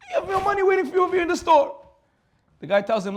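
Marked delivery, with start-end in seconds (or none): 0.1-1.8 much higher, louder yell of disbelief; exasperated shout. Acted. Doctor yelling at the butcher about his waiting money, shouting. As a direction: shout at him like he's lost his mind.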